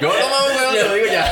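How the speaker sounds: high, giggly repeat of the line; laughing mock quote. Friend laughing as she repeats the punchline, high and giggly. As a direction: laugh it out while quoting her.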